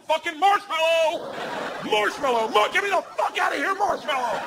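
very high hammed-up distress cries; panicked screaming. Man in a bear hug begging the crew, panicked screaming. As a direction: yell the safe word desperately, stretching it out.

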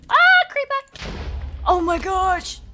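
high, loud panicked shriek; sudden fright at a monster; startled scream. Real, not acted. Creeper suddenly appears.

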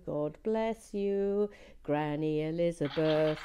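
slow, lightly Irish-lilted thanks; Irish blessing. Pretend. Thanking her Irish grandmother for the passport, slow Irish lilt. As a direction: bless Granny Elizabeth slowly with an Irish lilt. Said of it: "I do love a good Irish accent".

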